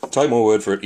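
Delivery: ordinary talk, nothing marked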